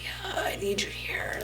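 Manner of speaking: barely there, pleading from a hospital bed; weak whisper. Retold or imitated. Injured boyfriend begging her to come, in a faint whisper. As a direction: whisper it, voice nearly gone.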